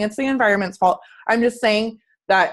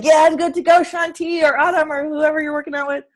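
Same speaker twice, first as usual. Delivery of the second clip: mock pumped-up; 7 semitones higher than usual. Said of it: "and you're like".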